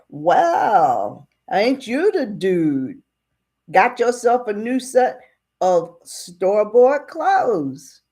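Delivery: slow, stretched-out marvelling; mock amazement. Deputy looking over boss's new clothes, amazed. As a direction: draw it out as if hugely impressed.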